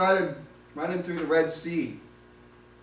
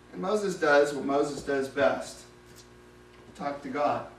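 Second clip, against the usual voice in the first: ordinary delivery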